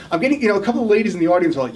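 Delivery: usual speaking voice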